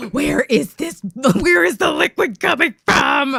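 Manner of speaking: loud high laughing wail; bewildered laughing yell, half crying. Retold or imitated. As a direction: shriek it through laughter. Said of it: "And I was like".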